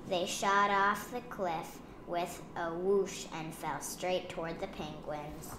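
plain reading voice

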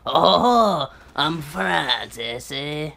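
hammed-up Frenchman; mock French accent. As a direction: put on a silly fake French accent. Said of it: "that's how they talk there".

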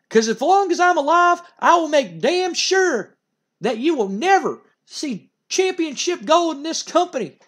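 a manager losing it at the wrestler; furious manager rant. Retold or imitated. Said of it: "And Jim Cornette goes".

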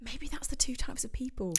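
soft whispered remark; whispered aside. Real, not acted. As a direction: drop to a whisper.

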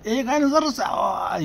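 bang on the door and bellow at the neighbor; furious retold yell; angry shout. Friend bangs on door shouting.

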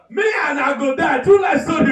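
high, loud mock yelling. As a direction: yell it like the frustrated man losing it. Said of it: "dey yell".

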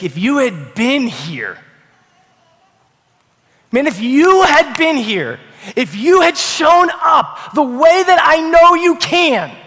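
hurt grief bursting out; anguished outcry. Retold or imitated. Mary crying out to Jesus over her dead brother, anguished shout.

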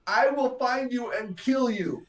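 high, slow threatening voice; hammed-up menace; mock threat. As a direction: knight threatens his rescuer, mock menacing. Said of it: "he says".

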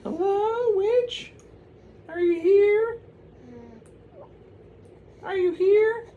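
very high, loud falsetto call. Acted. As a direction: call into the empty cabin in a squeaky voice. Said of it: "Madonna was like".

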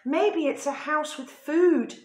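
hungry dog. A narrator's reading. Dog hopes for food, eager high voice. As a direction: bark it out like a greedy dog. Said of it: "said the dog".

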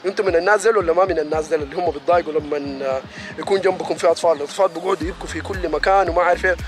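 ordinary delivery

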